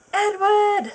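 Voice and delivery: high, loud shocked reveal; shocked cry. Acted. Reader reveals Edward in the room, hammed-up shocked cry. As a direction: gasp out the name in mock shock.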